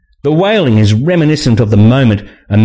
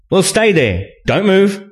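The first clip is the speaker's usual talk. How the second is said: raised-pitch instruction; lightly voiced command; 12 semitones higher than usual. A narrator's reading. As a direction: tell them to stay put, pitched up. Man instructs trapped woman.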